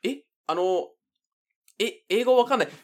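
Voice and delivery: high, baffled fisherman reply; confused Japanese fisherman. Pretend. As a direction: play the lost local who speaks no English. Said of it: "Japanese fisherman replies".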